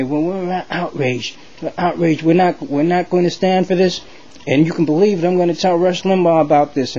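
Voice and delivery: high, indignant blustering voice; outraged congressmen. Acted. Members of Congress protesting to Obama, high indignant bluster. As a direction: puff up like a scandalized politician.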